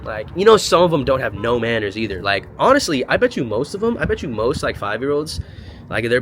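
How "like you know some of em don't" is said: mock call. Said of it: "I'd be like".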